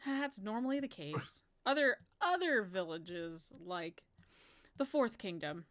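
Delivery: ordinary delivery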